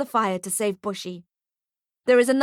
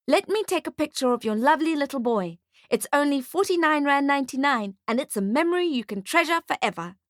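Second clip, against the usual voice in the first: higher-pitched, wheedling sales patter; sales-pitching photographer; 6 semitones higher than usual. A narrator's reading. Photographer pitches a keepsake picture to fawning mother, salesman's patter. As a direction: sell it to a fond parent.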